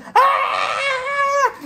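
long high-pitched mock scream; roller-coaster scream. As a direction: scream as if riding a coaster, hammed-up. Imagines screaming on hell's roller coaster.

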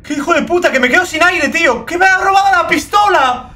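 real shout at a thieving creature; furious yell. Real, not acted. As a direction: scream in disbelief at the thief. Monkey stole pistol, out of air.

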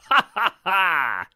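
slow, drawn-out guffaw in imitation; imitated laugh. Podcaster mimicking Vince reacting to the comedy, imitated laugh. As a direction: mimic a boss's big belly laugh. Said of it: "And Vince goes".

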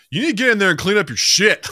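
high, loud mock scolding; hammed-up exasperated outburst; imitating annoyed parent. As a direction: yell the order like a fed-up mom. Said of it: "when Jill gets annoyed".

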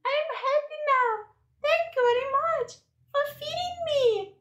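high-pitched pretend baby voice, happy and grateful. Baby thanks her carer for feeding her, happy high voice. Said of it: "She is happy".

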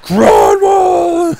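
hammed-up hockey war cry; mock battle yell. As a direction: yell your own name as you charge. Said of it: "He's just like".

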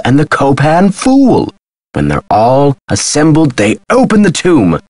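high-pitched, building excitement; excited revelation. Acted. Man explaining to Nancy how the tomb opens, excited. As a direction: reveal the secret piece by piece.

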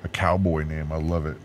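usual speaking voice

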